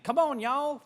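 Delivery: high, bright Southern "Come on, y'all"; southern drawl. Retold or imitated. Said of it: "my southern accent is creeping back in".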